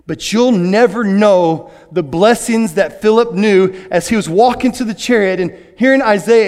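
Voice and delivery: ordinary talk, nothing marked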